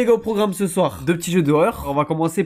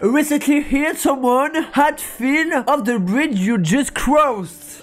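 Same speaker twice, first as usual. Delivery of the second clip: put-on higher, slow French-accented English reading; catastrophic French-accented English; 5 semitones higher, much slower than usual. Streamer reads a game character's dialogue, French accent. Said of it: "Voilà l'accent anglais catastrophique".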